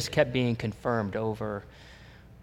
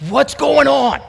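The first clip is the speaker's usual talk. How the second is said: high, loud, astonished cry of what's going on; mock bewildered shout; 14 semitones higher, 14 dB louder than usual. Tall wiry man asking the pastor, in a loud high bewildered shout. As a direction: burst out like a stunned man in church. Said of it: "he goes".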